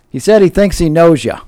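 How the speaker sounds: husband loudly repeating to his deaf wife; husband raising his voice. Retold or imitated. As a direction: say it up into her ear. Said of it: "And he goes".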